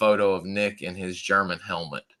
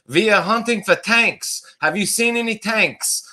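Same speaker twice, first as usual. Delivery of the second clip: hammed-up German accent, pitched much higher and louder; 11 semitones higher, 4 dB louder than usual. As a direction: play a soldier faking a thick German accent at the gate. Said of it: "In his best German accent".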